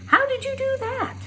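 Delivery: put-on astonished girl; amazed. A narrator's reading. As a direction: Flora amazed at the boy's story.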